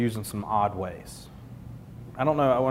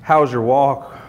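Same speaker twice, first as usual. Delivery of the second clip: pointed question; 6 dB louder, slower than usual. Retold or imitated. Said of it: "the question was".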